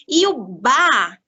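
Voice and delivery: slow, loud "ba" held long; drawn-out ba. Shown as a demonstration. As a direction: stretch out "ba" loud and slow for the child. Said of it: "o ba tem dois sons". ("ba" alongado e mais forte)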